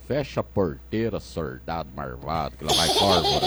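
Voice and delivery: put-on rural mineiro accent. Father's test phrase, said in a thick caipira accent. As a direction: imitate the backcountry accent, marvado for malvado. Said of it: "Ele falou assim, ó".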